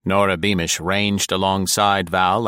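usual speaking voice

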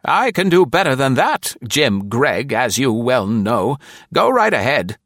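high put-on woman's voice, loud and sharp; high, loud sassy secretary; tart secretary Nora. A narrator's reading. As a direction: tease the boss from the back seat.

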